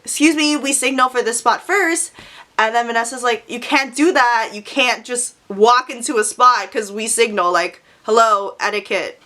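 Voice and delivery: ordinary talk, nothing marked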